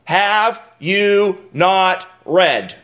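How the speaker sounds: very slow, each word spaced out; slow and weighty. Shown as a demonstration. Preacher slowing to stress Jesus' question. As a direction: drag out the four words for the congregation. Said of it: "critical four words".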